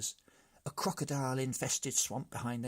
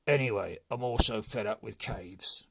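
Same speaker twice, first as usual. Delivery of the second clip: the brigand chief, fed up; gruff chief; 7 dB louder than usual. A narrator's reading. Chief complaining again.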